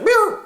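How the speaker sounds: high sung meow; a sharp, high cat meow; catty meow. Retold or imitated. The girls at the table teasing him, high catty meow. Table reacts to his confession.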